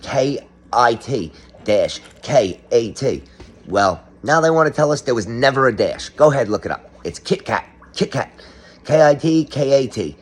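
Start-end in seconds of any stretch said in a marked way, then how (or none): 0.0-3.2 K I T dash K A T, each letter and the dash said slowly apart; slow spelling. Shown as a demonstration. Slowly spells the old Kit Kat logo to viewers. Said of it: "Remember it said Kit dash Kat". 8.8-10.2 K I T K A T spelled out higher and louder, letters run straight on; each letter hit, no dash between. Shown as a demonstration. Higher, louder spelling to viewers, no dash. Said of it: "no dash".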